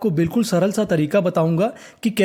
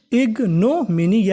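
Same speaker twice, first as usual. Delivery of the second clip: Ignominy drawn out slowly with a swinging tune; syllable by syllable; 2 semitones higher, much slower than usual. Shown as a demonstration. Teacher repeating ignominy for students, slowly, part by part. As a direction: stretch ig, no, mini apart in ignominy. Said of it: "क्या बन गई".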